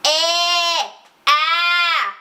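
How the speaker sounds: "E" sliding into "A" with a bright twang. Shown as a demonstration. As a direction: start on "E" and pull it into "A". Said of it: "eu pego do E e puxo pro A".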